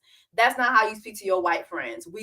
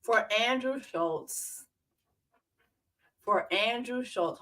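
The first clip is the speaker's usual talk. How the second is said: slow dramatic; 5 dB quieter, slower than usual. Real, not acted.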